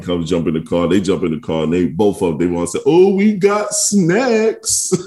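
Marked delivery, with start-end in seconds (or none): 2.9-4.9 put-on high, slow, delighted kid voice; imitating his sons' glee; excited kids. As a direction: stretch it out like thrilled kids. Kids excited about snacks.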